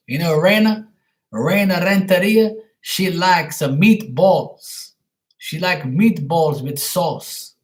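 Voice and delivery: exaggerated Italian accent, rising "huh?" tags; mock Italian accent. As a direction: play a cartoon Italian cook bragging in a thick Italian accent. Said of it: "My name is Marky Mario".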